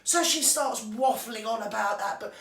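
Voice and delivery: ordinary talk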